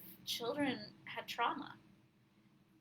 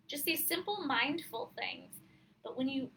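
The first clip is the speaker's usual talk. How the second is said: normal talking voice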